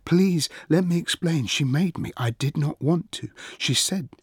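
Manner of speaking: high, urgent, tumbling explanation; plead, words falling over each other; stumbling plea. A narrator's reading. Achilles begs his friend to listen, stumbling frantically. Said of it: "he was stumbling over his words".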